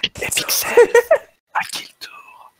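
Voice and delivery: soft breathy whisper. Acted. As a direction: hush it like a secret. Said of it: "Génialissime".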